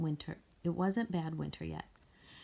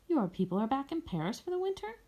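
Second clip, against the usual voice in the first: friendly question; 8 semitones higher than usual. A narrator's reading. Armand greets old friend Mireille.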